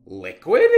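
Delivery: liquid punched out loud with a rising end; stress on liquid. Shown as a demonstration. Teacher to students, stressing liquid loudly. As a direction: lean hard on liquid for the class.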